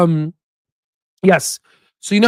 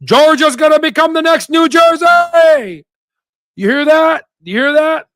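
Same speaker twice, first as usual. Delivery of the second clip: high, drawn-out hollering; shouted high-pitched announcement; 11 semitones higher than usual. Acted. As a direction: holler it out high like a town crier. Exclaims a loud proclamation to listeners.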